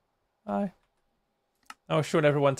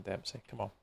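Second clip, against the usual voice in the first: usual speaking voice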